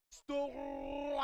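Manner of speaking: long rolled, drawn-out shout; loud, sustained play-by-play holler; hyped steal call. Real, not acted. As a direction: stretch the word out like an excited announcer.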